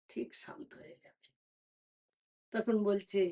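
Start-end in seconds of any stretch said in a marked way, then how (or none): 0.1-1.3 a soft whisper; whispered promise. Acted. Beggar whispers to the queen that her baby will calm. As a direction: breathe the reassurance almost silently.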